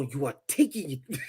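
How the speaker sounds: African accent, correcting "taking". Shown as a demonstration. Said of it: "No, no, no".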